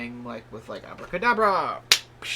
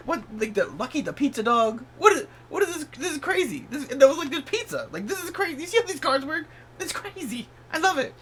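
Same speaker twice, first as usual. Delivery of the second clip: excited amazement; 11 semitones higher, 4 dB louder than usual. Real, not acted. Amazed at the tarot card.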